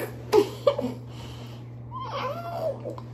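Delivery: high laugh. Real, not acted. Laughing at her numb lips.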